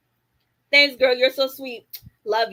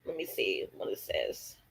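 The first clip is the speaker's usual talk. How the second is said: whispered aside; 12 dB quieter than usual. Real, not acted. Streamer whispering to herself while checking the chat. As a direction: whisper it to yourself, much softer.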